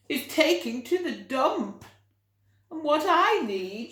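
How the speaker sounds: high, loud announcing voice; storybook lady with a new idea; Mrs Armitage declaring. Acted. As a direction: proclaim the new plan.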